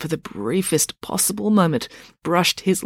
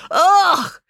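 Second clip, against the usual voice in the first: loud, higher grossed-out groan; disgusted ugh; 6 dB louder than usual. Acted. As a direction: groan out loud like a girl seeing something revolting. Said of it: "exclaimed April".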